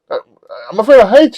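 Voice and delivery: high, loud mock-panicked yelp; panicked protest. Man ordered out the window, high panicked protest. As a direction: plead in a squealed stammer.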